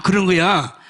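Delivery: ordinary talk, nothing marked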